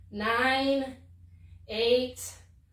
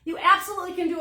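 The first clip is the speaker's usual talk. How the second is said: excited; 8 semitones higher, 5 dB louder than usual. Real, not acted. Enthusiastic instructor answering.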